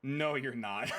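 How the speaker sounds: ordinary talk, nothing marked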